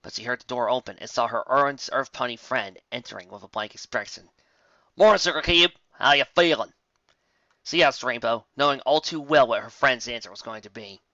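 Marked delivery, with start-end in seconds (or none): 5.0-6.7 higher and louder country mare greeting; Applejack's greeting. Acted. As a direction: call out a hearty morning hello.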